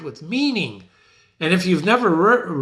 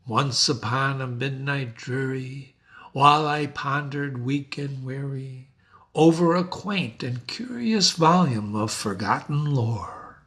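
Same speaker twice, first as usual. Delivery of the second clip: poem reading; 4 semitones lower, 3 dB quieter than usual. Shown as a demonstration. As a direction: read it like a hushed poem.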